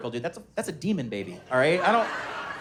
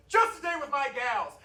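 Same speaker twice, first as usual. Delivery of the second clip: high, gushing put-on voice; mock-sentimental, pitched up; put-on sappy dad; 9 semitones higher than usual. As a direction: answer in a hammed-up sweet falsetto. Dad's sappy Father's Day answer.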